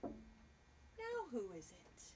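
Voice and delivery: usual speaking voice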